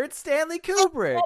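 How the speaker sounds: high-pitched mock insistence; insistent hopeful viewer. Voicing hopeful viewer insisting it improves.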